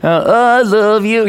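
quick imitation of a kids' show dinosaur; Barney. Reminding listeners of a little kid show, character imitation. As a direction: put on a goofy children's-show character voice. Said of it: "Barney, uh, you know, that little kid show".

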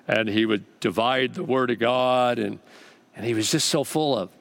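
ordinary delivery

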